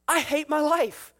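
high, slow mock lament; hammed-up exasperated outcry. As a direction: draw it out in despair. Solomon's despairing conclusion, voiced.

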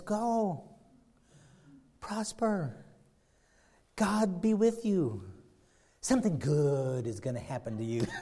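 soft, drawn-out fake benediction; slow, put-on pious sarcasm; mock blessing, sarcastic. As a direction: bless him with obvious insincerity. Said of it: "He saw the sarcasm".

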